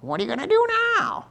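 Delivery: very high, fast, whining taunt; whiny devil. Retold or imitated. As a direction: sneer it in a squeaky devil voice. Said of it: "the devil just whispers in your ear".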